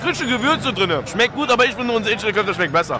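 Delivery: chewing while talking; mouth full. Real, not acted. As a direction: food in mouth after bite. Seen 2: bit dumpling at -1, speaks with mouth still working after.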